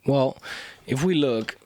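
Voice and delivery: breathless, struggling for air; out of breath. Real, not acted. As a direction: talk while short of breath. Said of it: "I'm very short of breath".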